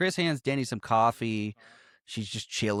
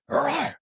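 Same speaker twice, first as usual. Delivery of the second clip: high growled bark; sudden wordless bark at someone; imitated bark; 14 semitones higher, 3 dB louder than usual. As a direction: bark it out like a dog. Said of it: "and barks at him".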